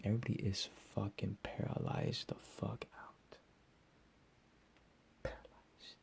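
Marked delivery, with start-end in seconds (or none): none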